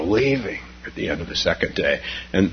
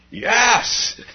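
eager yes; 13 semitones higher than usual. Acted. First meditator accepts support.

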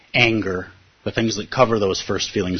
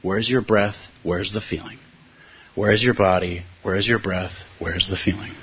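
softly guided inner questions, fading quieter; guiding practice questions; 3 semitones lower, 4 dB quieter than usual. Shown as a demonstration. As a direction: ask yourself gently, like a meditation guide. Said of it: "This is the practice we're taught to do".

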